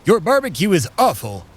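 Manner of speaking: usual speaking voice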